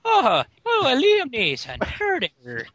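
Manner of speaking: high, loud Irish caricature falling away. Acted. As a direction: put on a hammed-up Irish brogue. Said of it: "Irish or something really offensive".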